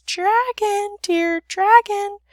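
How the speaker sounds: very high, thin little princess call; small voice drawn out high. A narrator's reading. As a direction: coax in a small, high voice, slowly. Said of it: "said in a very small voice".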